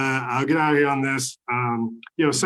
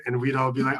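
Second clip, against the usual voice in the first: ordinary talk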